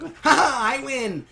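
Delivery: high gloating cackle into a boast; mock triumph. Peridot as Pearl lands on the ground, mock triumph. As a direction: crow over the beaten rival.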